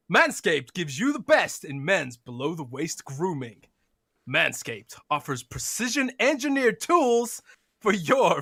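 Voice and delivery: mock American ad read, light American accent; hammed-up American accent sponsor read. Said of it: "in your, uh, American accent".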